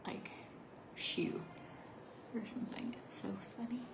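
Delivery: soft unvoiced whisper. Real, not acted. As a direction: whisper the aside. Whispered aside.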